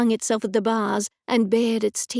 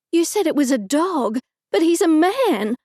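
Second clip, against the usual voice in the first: horrified cry, rising louder; in horror; 6 semitones higher, 5 dB louder than usual. Acted. Narrator horrified the dog is a man, crying out. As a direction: blurt it out in shock and horror. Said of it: "I said in horror".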